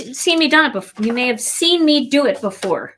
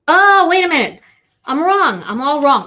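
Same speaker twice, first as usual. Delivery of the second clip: sudden realization; 7 semitones higher, 6 dB louder than usual. Real, not acted. Catches her own mistake mid-demo.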